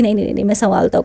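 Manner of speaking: quickly brushing off the worry; hasty reassurance. Retold or imitated. Trip manager hastily reassures her. (जल्दी-जल्दी तसल्ली देते हुए)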